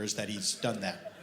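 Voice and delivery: usual speaking voice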